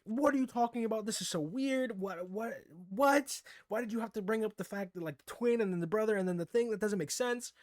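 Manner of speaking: high-pitched horrified rant; retold freakout. Viewer ranting at Oka's confession, high and panicked. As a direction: replay your panicked fit at the screen. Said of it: "I was having a whole fit".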